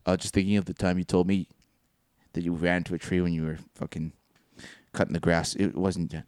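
low, soft, halting confession; sheepish admission. Retold or imitated. Laugher confessing to upset friend, low and sheepish. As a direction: admit it reluctantly, trailing along. Retold sheepish answer to demand.

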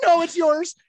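high, loud imitation of a giddy waitress; imitating uncontainable excitement. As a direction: blurt it like she can't keep it in. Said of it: "she couldn't even keep it in".

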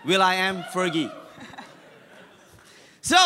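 normal talking voice

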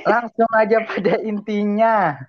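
slow, high, drawn-out host intro; dramatic announcer. Acted. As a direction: announce it slowly like a showman. Dramatic show opening line.

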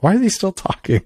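high, put-on voice for an impatient listener; mock bored listener. Congregation mocked by host for rambling, high mock voice. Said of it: "People just kinda are like".

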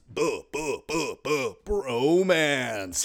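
drawn-out cooing boo boo; sing-song boo. Acted. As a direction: coo it like teasing lovebirds. Playful bromance sing-song.

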